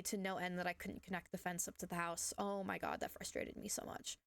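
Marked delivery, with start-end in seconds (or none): none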